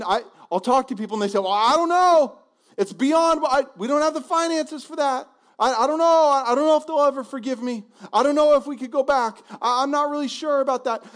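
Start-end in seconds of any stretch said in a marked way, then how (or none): 1.4-11.0 high, loud fretting excuses; a worried churchgoer doubting the call; anxious doubter. Retold or imitated. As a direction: wring your hands over every excuse. Preacher quoting doubting people.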